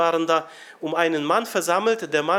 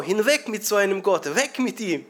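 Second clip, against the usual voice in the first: higher, shouted rejection, hammed up; mock shout; 5 semitones higher than usual. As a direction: cry it out like a protester. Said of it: "angefangen zu schreien". (gespielter Schrei, höher und laut)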